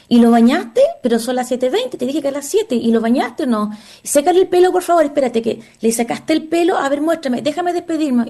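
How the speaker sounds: high, anxious mother nagging home by phone; mother checking in on the phone, high and frantic; fussing mother on phone. Retold or imitated. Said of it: "he visto mujeres que se van y que están llamando".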